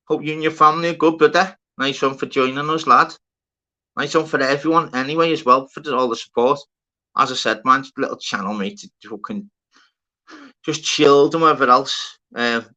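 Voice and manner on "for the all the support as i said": usual speaking voice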